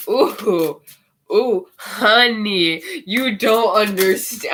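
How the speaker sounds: loud exasperated ooh honey, drawn out; livid. Real, not acted. Reading tarot, fed up with the King of Cups, loud and livid. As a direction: vent real fury at the card, loud. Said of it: "I'm so livid right now".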